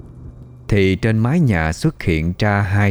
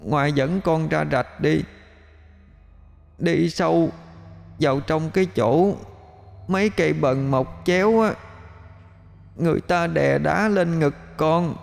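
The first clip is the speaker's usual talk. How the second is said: cold eerie ghost voice; 8 semitones higher, 5 dB quieter than usual. A narrator's reading. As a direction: drift the words in from somewhere far and cold. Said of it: "nhưng không còn là giọng của nó nữa".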